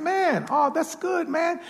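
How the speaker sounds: high, delighted praise; impressed exclaim. Retold or imitated. Preacher admiring the shoe shine, high and delighted. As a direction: gush over the shine like a friend.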